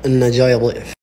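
deep pretend man's voice. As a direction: drop the voice low like a grown man. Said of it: "غير صوتك".

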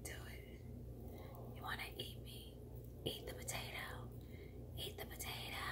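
tempting whisper of a talking potato. Pretend. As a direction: whisper like food luring you. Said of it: "He's like".

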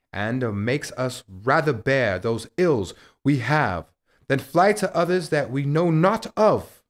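loud, rising theatrical declamation; swelling Shakespearean outburst; impassioned soliloquy. Acted. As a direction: build in force and pitch to the end. Soliloquy builds to its climax.

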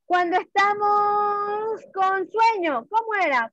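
ordinary talk, nothing marked

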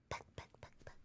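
whispering. Pretend. Imitating a chick hatching from egg.

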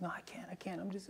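tearful whisper. Retold or imitated. Daughter whispering her refusal at bedtime. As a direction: whisper it as if holding back tears. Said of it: "And she said".

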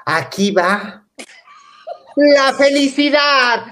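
slow, rising to a high loud proclamation; slow ceremonial build to a loud high finish; grand announcement. Retold or imitated. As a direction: announce it like a showman. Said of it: "dice la piruja".

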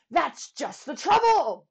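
loud, booming royal bellow; booming warrior king. Acted. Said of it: "bellowed the king".

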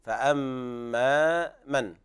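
drawn-out Quranic recitation with nasal ghunna; slow recitation. Shown as a demonstration. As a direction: recite slowly, holding the meem. (تلاوة بطيئة مع غنة)

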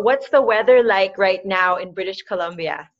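normal talking voice

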